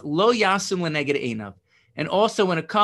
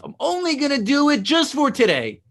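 bright, much higher and louder self-talk; hopeful inner voice; 8 semitones higher, 5 dB louder than usual. Acted. Telling himself it is only for today, high and eager. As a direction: voice the resolve you tell yourself, lifted high. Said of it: "when you think to yourself".

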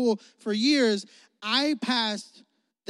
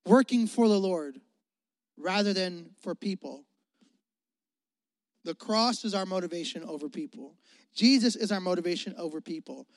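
ordinary talk, nothing marked

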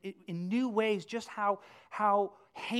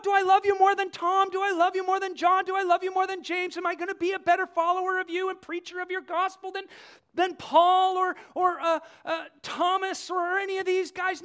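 mock comparing; 10 semitones higher, 9 dB louder than usual. Mocking Peter's rivalry.

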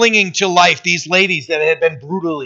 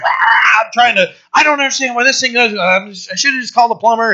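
higher, fast mock frustration at the plumbing; frustrated grumble; 5 semitones higher, faster than usual. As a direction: grumble like a stuck handyman. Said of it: "and I'm getting mad".

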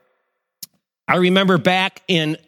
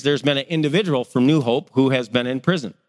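ordinary talk

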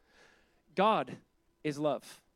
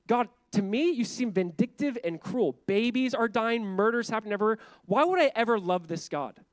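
angry complaint; 5 semitones higher, 5 dB louder than usual. Retold or imitated. Voicing an angry unbeliever.

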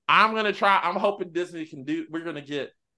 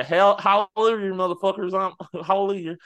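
Madea voice; 6 semitones higher, 4 dB louder than usual. Retold or imitated. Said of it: "Madea comes in at the end and say".

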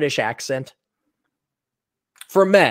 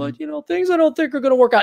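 high, hurried put-on woman's voice; mock girly excuse; 11 semitones higher, faster than usual. Gals turning down the short guy, mock high hurried excuse. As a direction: rattle off the excuse in a raised mimicking pitch. Gals' excuse continues, acted.

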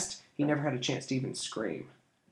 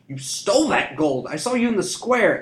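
angry shout; 7 semitones higher, 6 dB louder than usual. A narrator's reading. Boy accuses thief.

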